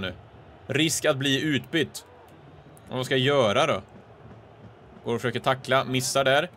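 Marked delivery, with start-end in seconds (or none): none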